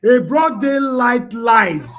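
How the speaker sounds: loud, high, drawn-out mock lament; mock wailing. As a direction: wail it slowly, as if joining the crying. Host wails along about the lies.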